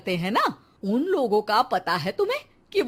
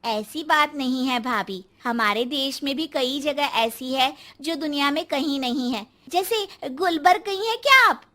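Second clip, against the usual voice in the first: ordinary talk, nothing marked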